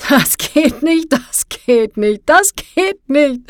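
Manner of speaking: high, slow, loud laughing mockery; mocking laughter. A narrator's reading. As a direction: laugh it back at him, hooting. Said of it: "lehnte sich zurück und lachte".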